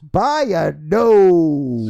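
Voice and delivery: very slow, loud, drawn-out race call; race commentator call. Pretend. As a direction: stretch it out like the photo finish. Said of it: "sounded like a horse race commentator".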